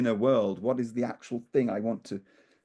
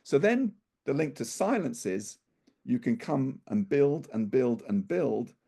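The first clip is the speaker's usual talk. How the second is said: usual speaking voice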